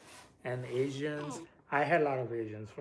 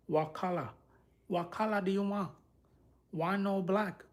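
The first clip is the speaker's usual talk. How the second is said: higher-pitched mock Vietnamese or Filipino accent; imitated Vietnamese-Filipino accent; 7 semitones higher, 3 dB louder than usual. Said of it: "I like those, uh, accents".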